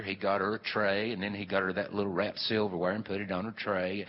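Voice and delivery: ordinary delivery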